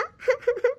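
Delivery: high laugh after the scene; cracking up at the end; laugh breaks out. Real, not acted. (ನಗು ಉಕ್ಕಿ ಬರುವುದು)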